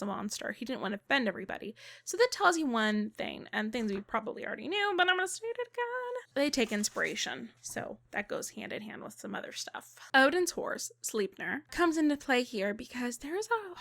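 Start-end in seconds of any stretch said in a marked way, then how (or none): none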